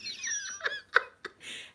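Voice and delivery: high giggling laugh; high delighted cackle; high laughter. Real, not acted. Laughing at the tax story.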